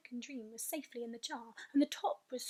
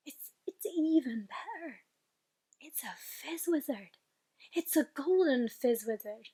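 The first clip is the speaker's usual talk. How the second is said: thrilled; 3 dB louder than usual. Acted. BFG thrilled over caught dream.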